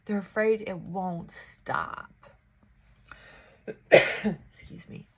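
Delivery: usual speaking voice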